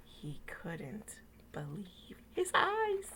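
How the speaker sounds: slow, hushed whisper for suspense. Acted. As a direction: build the suspense softly. Suspense before the surprise.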